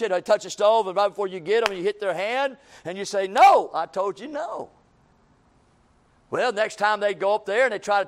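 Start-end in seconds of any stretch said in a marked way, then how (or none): 3.3-4.7 slow, loud parental no; stern parent. Retold or imitated. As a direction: scold the child, firm and slow. Parent scolding a child.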